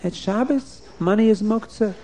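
put-on a small girl pleading it is Shabbos; protesting girl.

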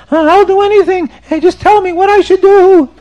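high, loud hammed-up wail; crying like a baby. Sinner confesses to the Baal Shem Tov, mock bawling. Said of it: "crying and screaming in the shul like a baby".

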